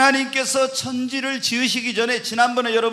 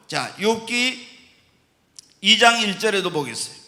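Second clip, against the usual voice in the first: usual speaking voice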